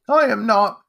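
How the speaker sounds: put-on high, loud childish denial; defensive boy's retort; indignant young boy. A narrator's reading. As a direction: protest hotly in a high voice. Boy denies crying.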